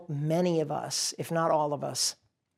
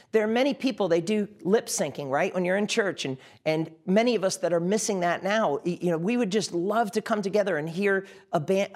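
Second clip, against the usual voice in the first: ordinary delivery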